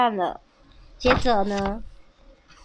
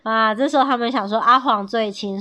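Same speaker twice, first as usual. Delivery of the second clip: plain reading voice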